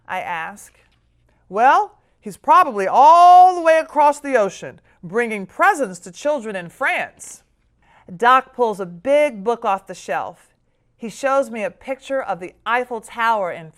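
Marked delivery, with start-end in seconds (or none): 1.5-7.4 loud, higher storytelling grandpa. Acted. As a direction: spin it like a tale. Grandpa tells child about Santa.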